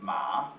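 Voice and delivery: MA dipping low, then curling up; questioning dip tone. Shown as a demonstration. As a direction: dip deep on MA like a doubtful question. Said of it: "we call it the integration accent".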